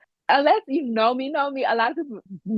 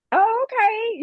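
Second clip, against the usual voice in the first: high, drawn-out agreeing okay; imitating relatives who just go along; mock going-along okay; 13 semitones higher, slower than usual. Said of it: "and they just be like".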